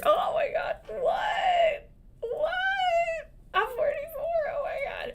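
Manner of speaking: shrieked, very high mock-horror what; hammed-up shock at your own age; mock panic. Realizing after a mountain trip she's forty-four, shrieking mock panic.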